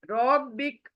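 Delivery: normal talking voice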